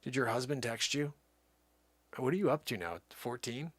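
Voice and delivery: ordinary talk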